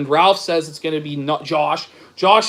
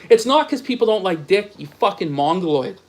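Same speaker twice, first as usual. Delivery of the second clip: normal talking voice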